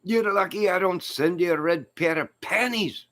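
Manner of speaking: high, squealing comic taunt; mock threat. Comic threatens Greeno with a prank parcel, mock taunting. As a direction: tease him in a put-on high voice.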